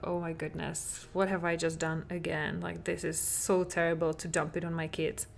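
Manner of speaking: dropped to a hushed, regretful murmur; remorseful murmur. Retold or imitated. Mom regretting yelling at her kids, hushed and remorseful. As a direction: say it softly, ashamed of what you just did. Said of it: "you felt like".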